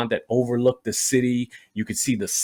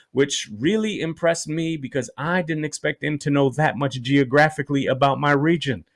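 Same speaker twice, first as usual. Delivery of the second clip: ordinary delivery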